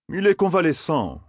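objecting insistently. A narrator's reading. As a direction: press the point, pitch lifted high. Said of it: "insista Gawain".

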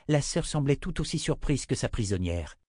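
usual speaking voice

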